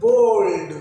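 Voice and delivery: बोल्ड। stretched as a single syllable, falling; one-syllable demonstration. Shown as a demonstration. Teacher showing students the mouth opens once, one-syllable बोल्ड।. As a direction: say बोल्ड। long and loud in one mouth movement. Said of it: "एक बार आपका मुंह खुल रहा है".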